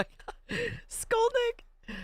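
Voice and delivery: very high squeal of a name; delighted squeal. Real, not acted. Squealing hello to a chatter who just arrived.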